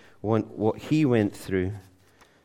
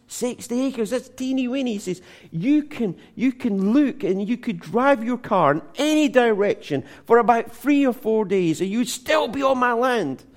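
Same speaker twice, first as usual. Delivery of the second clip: scornful Texan boast; 11 semitones higher, 4 dB louder than usual. Retold or imitated. Texan mocks small farm, boasts.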